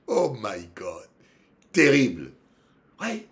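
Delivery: ordinary delivery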